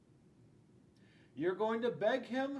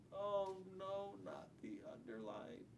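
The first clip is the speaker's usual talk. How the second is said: despairing murmur; 9 dB quieter than usual. Acted. Despair over the underline.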